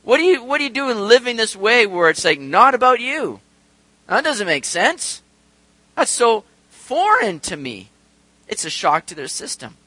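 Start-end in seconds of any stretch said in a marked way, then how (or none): 0.0-7.9 loud, high, baffled disbelief; hammed-up whoa at something unheard of; imitating worldly people shocked by grace; mock astonishment. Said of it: "They're all saying, like".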